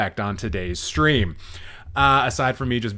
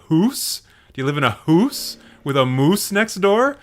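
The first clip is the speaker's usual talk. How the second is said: higher, teasing Canadian accent with "hoose" for house; mock Canadian accent; 4 semitones higher than usual. Shown as a demonstration. Host teasing chat about saying house, mock Canadian accent. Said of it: "that's probably more of the Canadian accent".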